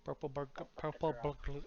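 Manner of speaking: tongue-tied Scottish accent on "Purple burglar"; Scottish accent attempt. Shown as a demonstration. GM trying a Scottish accent for the players, Scottish accent. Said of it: "if you try and do a Scottish accent".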